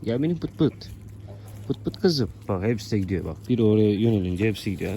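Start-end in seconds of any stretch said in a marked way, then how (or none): 0.0-2.3 coaxing pet talk. Real, not acted. Coaxing poultry to come.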